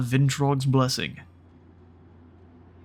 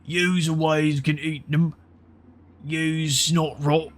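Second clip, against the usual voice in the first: slow, higher, dopey giant drawl; two-headed giant; 5 semitones higher, slower than usual. Acted. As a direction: stretch it out like a dim brute. Giant head speaking in dialect.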